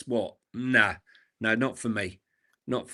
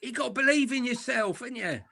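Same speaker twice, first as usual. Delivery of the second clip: a light, higher take on a preachy ex-England manager; lightly voiced football pundit giving earnest advice; Glenn Hoddle; 10 semitones higher than usual. Co-host imitating the pundit to mock his advice, lightly voiced. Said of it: "That was more Glenn Hoddle".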